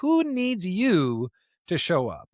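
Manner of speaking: high, drawn-out NEEDS YOU; emphatic refrain. Real, not acted. Preacher repeating the call to show up, drawn-out needs you. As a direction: land the refrain slowly, stressing needs you.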